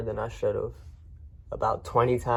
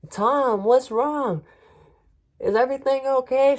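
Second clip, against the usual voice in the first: much higher voice of a concerned woman calling out; worried neighbor; 13 semitones higher, 5 dB louder than usual. Retold or imitated. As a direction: play the anxious lady next door.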